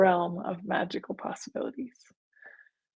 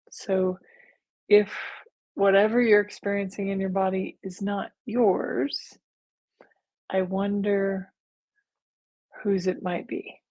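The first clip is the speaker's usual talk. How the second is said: normal talking voice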